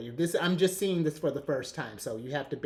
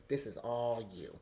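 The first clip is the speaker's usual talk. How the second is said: ordinary talk, nothing marked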